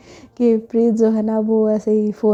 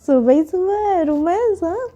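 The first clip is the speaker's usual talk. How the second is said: teasing; 5 semitones higher than usual. A narrator's reading. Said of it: "उसे छेड़ते हुए कहती है".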